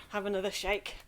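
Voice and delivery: ordinary talk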